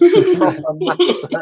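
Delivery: high, slow laugh through the words; real laughter breaking in. Real, not acted. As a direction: crack up while saying it. Laughing at the story.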